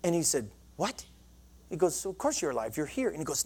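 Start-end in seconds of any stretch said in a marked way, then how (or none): 0.8-1.1 softly voiced, taken-aback doctor; quiet, baffled question; mock puzzlement. Counselor to a patient who claims he's dead, quietly puzzled. 2.1-3.1 low, soft doctor's reply; quiet reassurance. Retold or imitated. Doctor to patient who thinks he's dead, softly reassuring.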